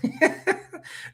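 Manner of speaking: breathy high giggle; high laugh. Real, not acted. Amused by cartoon scene.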